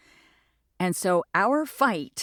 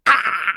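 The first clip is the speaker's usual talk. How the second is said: mock attack cry; 3 semitones higher, 9 dB louder than usual. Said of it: "like, went".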